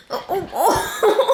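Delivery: high, slow laughing oh's. Real, not acted. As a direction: laugh it out in disbelief. Laughing at the cats.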